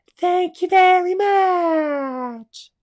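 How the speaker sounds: very high, very loud, slow squealing frog; big mouth frog voice. Acted. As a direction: shout it like a huge-mouthed cartoon frog. Frog thanks squirrel.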